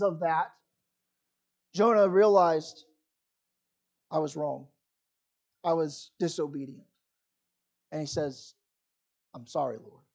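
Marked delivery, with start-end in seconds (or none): 4.1-10.0 soft, lower, repentant murmur; hushed and sorry, owning the wrong; contrite confession. Retold or imitated. Jonah admitting his sin to God, quiet and contrite.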